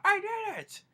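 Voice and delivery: high-pitched imitated outburst; mock yell. Grandpa eating the angriest Whopper, high mock yell. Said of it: "with his mouth full, and he's like".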